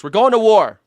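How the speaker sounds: high, loud mock alarm; shouted war warning, half joking; mock panic. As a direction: sound the alarm, hammed up. Repeats louder, mock alarm.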